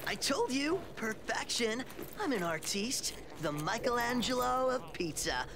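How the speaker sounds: boastful artiste. Acted. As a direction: crow about your pizza like a vain artist. Mike boasts about his pizza.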